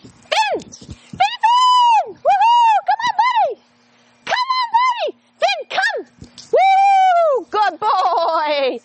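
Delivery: high, loud, excited calling; happy tone of voice. Shown as a demonstration. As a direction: whoop him in like a party. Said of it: "happy tone of voice".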